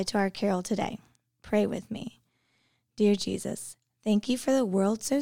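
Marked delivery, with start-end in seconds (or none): none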